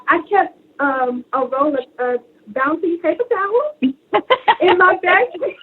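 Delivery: ordinary talk, nothing marked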